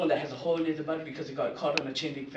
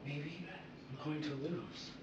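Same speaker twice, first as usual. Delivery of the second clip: very soft, hesitant aside; inner thought; 14 dB quieter than usual. Acted. Batman doubting himself before the gun, hushed. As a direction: murmur it softly, with a pause. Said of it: "And I think to myself".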